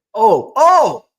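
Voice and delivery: a high, loud burst of sudden remembering; oh, it just hit you, blurt it out; sudden recall. Real, not acted. Remembering a story to tell viewers, high loud sudden oh.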